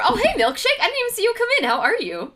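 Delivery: high, loud happy hello; delighted greeting. Real, not acted. Streamer greeting a viewer who just arrived in chat.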